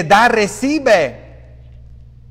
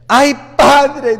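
higher, louder anxious plea; frightened parishioner; 6 semitones higher, 5 dB louder than usual. Retold or imitated. A frightened man begging the priest for help. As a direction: call out to the priest like a scared man. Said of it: "un señor que me habló y me dice".

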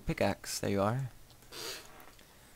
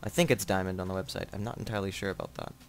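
ordinary talk, nothing marked

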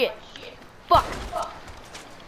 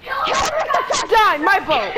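sudden loud cry of loss; anguished shout; 7 semitones higher, 4 dB louder, much slower than usual. Real, not acted. Son shouting as his game boat gets destroyed, anguished yell.

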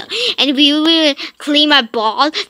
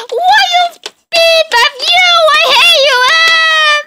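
much higher, louder demanding yell; angry kid shout; 9 dB louder, slower than usual. Acted. Son yelling at dad over the TV, angry.

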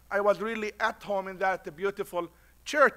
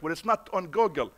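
usual speaking voice

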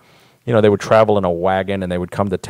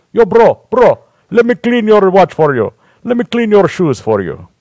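put-on much higher and louder, Romanian street-hustler accent; 10 semitones higher, 7 dB louder than usual. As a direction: push the Romanian accent hard, bro-ing him. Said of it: "You can spot their accent".

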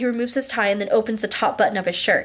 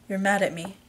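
hushed, softer half-whisper; 2 semitones lower, 6 dB quieter than usual. A narrator's reading. Husband to angry wife in the car, near whisper. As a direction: say it softly, close to a whisper. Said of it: "he whispers".

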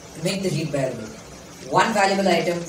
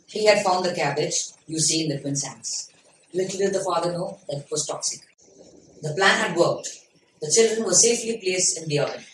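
ordinary talk, nothing marked

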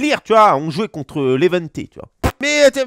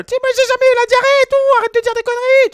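voix aiguë de Messi; 4 dB louder than usual. Retold or imitated. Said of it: "Parce qu'il a une voix un peu aiguë".

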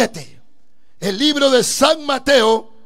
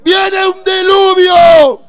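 slow, drawn-out shout, higher; proclaiming shout; 6 semitones higher, 8 dB louder, much slower than usual. Retold or imitated. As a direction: cry out the warning slowly. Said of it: "ese era el mensaje de Noé".

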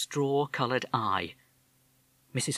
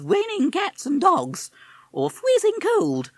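very high, slow plummy toff voice; high slow upper-class parrot; affected posh parrot; 6 dB louder, slower than usual. Acted. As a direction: drawl it like a chinless wonder. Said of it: "the affected way he spoke, like a chinless wonder".

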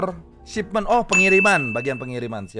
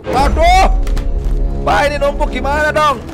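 panicked; 9 semitones higher, 4 dB louder than usual. Real, not acted. Pile-up in game.

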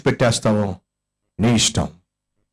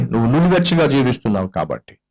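normal talking voice

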